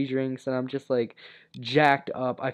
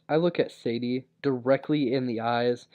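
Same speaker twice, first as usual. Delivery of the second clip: usual speaking voice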